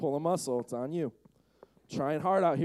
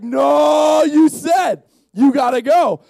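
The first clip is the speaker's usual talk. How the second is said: high, loud, drawn-out protest; insistent shouting; 9 semitones higher, 12 dB louder, much slower than usual. Acted. Other disciples pushing Peter to go, insistent. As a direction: gang up and yell it.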